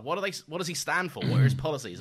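high, loud demanding questions; insistent questioning. Retold or imitated. Doctor demanding answers, retold.

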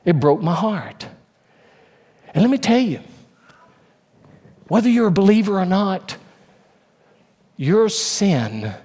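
ordinary delivery

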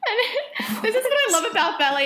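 high, loud laughing aside; real laughter while telling the story. Real, not acted. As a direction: burst out laughing mid sentence. Laughing recounting ballet fall.

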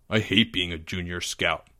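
low, soft, sulky complaint; grumble. A narrator's reading. Greg denied a new title, low sulky grumble. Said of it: "Greg grumbled".

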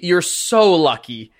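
"so" drawn out, high and emphatic; stress on SO. Real, not acted. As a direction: lean long and hard on "so". Game master repeats it at Benji.

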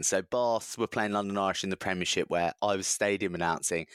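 ordinary delivery

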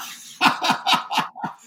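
high, loud burst of laughter. Real, not acted. Teller and listener share the twist, big real laugh.

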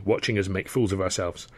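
ordinary delivery, nothing marked

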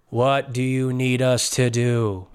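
slow, mindless group reply; slow question from a mindless follower. Acted. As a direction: drag it out like obedient drones. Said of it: "make him not as mindless".

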